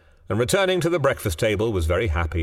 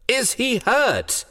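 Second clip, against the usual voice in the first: high, slow, frantic cry of a frightened mother; 어머니가 겁에 질려 높고 느리게 다그침; wild demand; much slower than usual. A narrator's reading. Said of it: "demanded the mother wildly".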